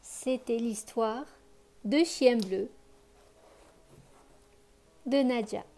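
put-on lively read-aloud voice for children; expressive bedtime story reading; story narration. A narrator's reading. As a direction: tell the tale warmly to a child listener.

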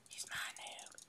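unvoiced whispered taunt; whispered gloat. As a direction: whisper it smugly. Gloating over victim.

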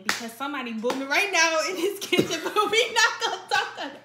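giggling tease; laughing while talking. Real, not acted. Teasing someone laughing.

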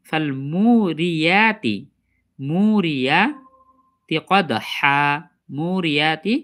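very slow মুরিয়াতি with long মু and full ইয়া; careful recitation. Shown as a demonstration. Teacher modelling the correct syllable, careful recitation. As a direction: stretch মুরিয়াতি and hold the হা of কদহা. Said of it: "ইয়া উচ্চারণ করব".

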